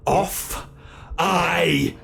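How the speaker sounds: high, loud, delighted shout; gleeful shout. Acted. Captor bolting off to start the chase, shouting. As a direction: call it out as you dash away.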